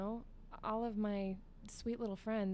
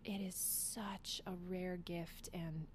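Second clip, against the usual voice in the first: soft sincere; 6 dB quieter, slower than usual. Real, not acted. Heartfelt gratitude.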